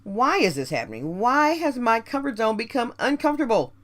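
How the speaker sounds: ordinary talk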